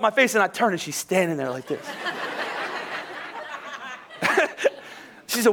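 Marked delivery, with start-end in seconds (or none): 1.9-3.9 high, breathy laugh at himself; a preacher cracking up at his own story; real burst of laughter. Real, not acted. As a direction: laugh out at getting caught. Laughing at caught moment.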